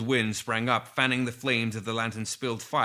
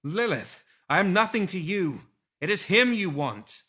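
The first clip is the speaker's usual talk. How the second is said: higher, urgent pleading while running; desperate plea; 8 semitones higher, 4 dB louder than usual. A narrator's reading. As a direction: beg for your life, pitched up. Rasputina pleads while fleeing.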